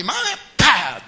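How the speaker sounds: put-on slow, loud child's wail; child crying out. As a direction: play the hit kid calling out. Child's cry mimicked.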